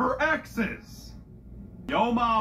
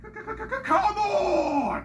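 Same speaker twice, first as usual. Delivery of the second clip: stuttering shout; 10 semitones higher than usual. Acted. Stuttered exclaim.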